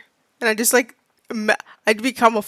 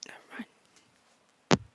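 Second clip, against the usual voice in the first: breathy whisper, slowly trailing off; whispered never mind; 8 dB quieter, much slower than usual. Real, not acted. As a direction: whisper it as if taking it back. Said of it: "And then went".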